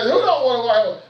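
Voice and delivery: high, loud mock disbelief; excited retelling. Preacher replays last night's joke to congregation, loudly incredulous. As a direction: cry out the question in comic disbelief. Repeats the joke, much louder.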